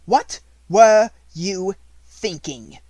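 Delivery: loud, high, slow outraged shout; furious. A narrator's reading. Headmistress raging at a defiant pupil, loud and slow. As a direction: explode with outrage, dragging every word. Repeat, enraged.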